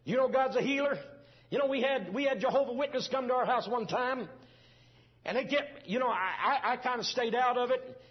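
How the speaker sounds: high, loud preacher fervour; fervent preaching. Acted. Preacher opening story at full force.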